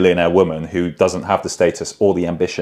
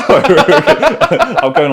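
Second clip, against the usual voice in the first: laughing; 12 semitones higher, 8 dB louder than usual. Real, not acted. Laughing while answering.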